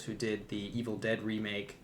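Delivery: ordinary talk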